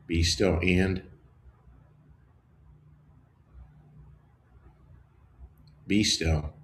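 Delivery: slow, low meditative voice with long pauses; slow contemplative prayer reading; guided meditation. Acted. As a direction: let each shortened line settle into silence. Leads a meditation.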